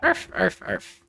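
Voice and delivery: small, soft mock arfs; puppy barks. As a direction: yap quietly like a puppy. Puppy persona barking noises.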